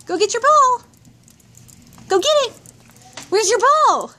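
high, eager calls to the dog; excited dog talk. Real, not acted. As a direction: pitch it up for the dog. Urging dog.

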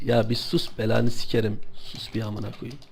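low, hushed scold. Real, not acted. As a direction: hush them sharply under the breath. Hushing chat to listen.

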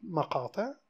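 ordinary talk

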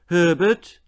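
loud, high questioning call. Acted. Mother searching the sitting room, loud rising call. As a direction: call his name into the room.

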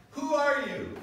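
high, loud demand; loud high questioning call. Acted. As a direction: call it out challenging. Acted question to Jesus.

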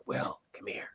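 whispered call from a stoned drummer; stoned drummer whispering to his bandmate. Retold or imitated. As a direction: whisper it, beckoning. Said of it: "he's like".

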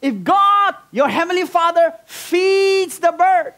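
high, loud, drawn-out proclamation; emphatic preaching. Real, not acted. Preacher assuring church God provides, emphatic preaching. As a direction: build the phrase slowly and forcefully, pitched high.

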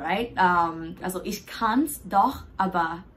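ordinary talk, nothing marked

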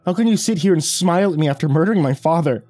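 high, loud, anguished accusation; outraged demand. A narrator's reading. Bereaved child accusing the father's murderer, high outrage. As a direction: raise the voice in sustained outrage. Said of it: "I demanded".

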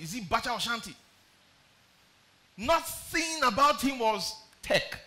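high, pressing preaching cry; rising sermon fervour; impassioned preaching. Acted. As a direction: preach it up at the church.

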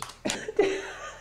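a breathy giggle held back; muffled laugh under the breath; stifled giggles. Real, not acted. Reacting to funny clip.

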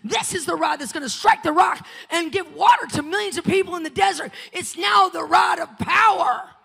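loud, rising preacher shout over music; preaching at full force. Acted. Preacher to the congregation, full-force shouting sermon.

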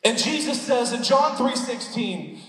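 rising passionate pulpit delivery; full-force preaching. Acted. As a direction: preach it out to the whole room.

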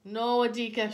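loud, high-pitched call of protest; crying out. A narrator's reading. Mama as her son runs ahead, crying out. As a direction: cry out to stop the child. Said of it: "she cried out".

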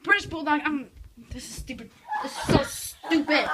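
ordinary talk

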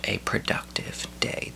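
low, slow near-whisper; hushed repeat. Real, not acted. Vlogger repeating his sign-off to himself, hushed. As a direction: drop to a slow, low murmur.

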